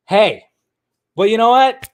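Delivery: ordinary talk, nothing marked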